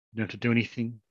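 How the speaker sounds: ordinary talk, nothing marked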